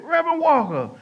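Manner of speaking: high, loud friendly greeting; lightly voiced hearty stranger; a hint of a cheerful stranger greeting him in a store; stranger's greeting. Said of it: "That's how he was talking".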